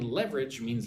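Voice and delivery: usual speaking voice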